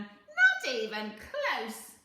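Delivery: a lightly voiced scheming little man, slow and loud; sly little man. A narrator's reading. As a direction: bargain like a crafty imp.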